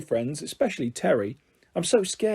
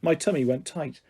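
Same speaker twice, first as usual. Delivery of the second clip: usual speaking voice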